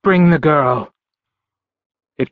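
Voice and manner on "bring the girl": calling out in a commanding voice; raised voice. A narrator's reading. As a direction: order it loud across the bridge. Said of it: "he raised his voice and said".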